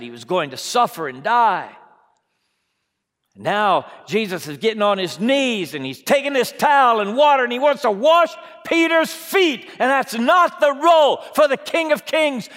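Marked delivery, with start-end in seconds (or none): none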